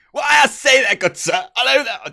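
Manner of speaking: put-on high, loud ye olde English accent; hammed-up Victorian toff, pitched high; Victorian English gentleman. Gamer greeting a shop man in roleplay, ye olde Victorian English. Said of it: "a ye olde English Victorian-y style".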